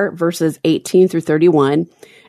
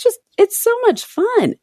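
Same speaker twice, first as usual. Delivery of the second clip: gushing. Real, not acted. Gushing about listeners' posts.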